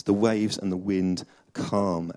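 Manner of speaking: usual speaking voice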